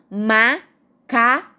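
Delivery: "Macaco" split as ma, ca, co; three separate beats in "Macaco", gaps between; syllable by syllable. Shown as a demonstration. Teacher showing a three-syllable word, "Macaco" said in separated syllables. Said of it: "A gente pronunciou três vezes separado".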